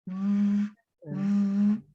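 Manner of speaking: low, steady "V" buzz; sustained voiced V. Shown as a demonstration. Teacher letting learners hear V sound, sustained voiced V. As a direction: hold "V" on a low hum. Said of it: "no suena la v de Victor".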